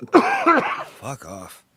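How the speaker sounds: hoarse after coughing; smoker's rasp. Real, not acted. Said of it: "this fucking smoker's cough".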